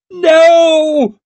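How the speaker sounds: loud, very high shriek of horror. A narrator's reading. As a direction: shriek it across the chamber. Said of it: "shrieked Lady Wetterland".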